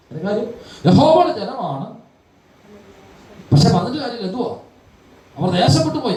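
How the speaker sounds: high, impassioned sermon voice; fervent preaching. Acted. Preacher explaining Ezekiel to the congregation, impassioned and high. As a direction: drive the point home from the pulpit.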